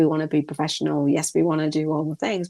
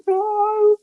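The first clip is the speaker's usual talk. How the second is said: long, very high, loud "no"; mock wailing protest; 5 dB louder than usual. Host protesting a call during her live, mock wail.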